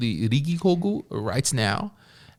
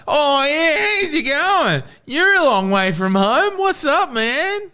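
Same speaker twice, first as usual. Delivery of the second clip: high, cheery mock Aussie greeting; high-pitched mock Australian welcome; imitating Australian barman; slower than usual. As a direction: ham up an Australian bloke greeting you at the bar. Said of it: "you walk into the bar and it's like".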